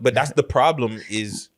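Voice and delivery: ordinary talk, nothing marked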